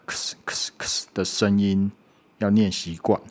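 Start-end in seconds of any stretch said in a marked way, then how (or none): 0.1-1.1 fast crisp ks, ks, ks; ks ending sound. Shown as a demonstration. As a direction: snap out the ks alone. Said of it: "后面那个크스". (快速清楚地念ks音)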